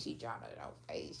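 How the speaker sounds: low, quiet mocking murmur; hushed mock aside. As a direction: drop it to a low hush. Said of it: "mocking people's reaction".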